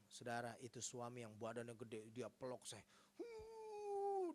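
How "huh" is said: long high wail, sliding down; hammed-up sob; mock crying wail. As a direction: imitate a big man bawling, high and drawn out. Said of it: "dia nangis".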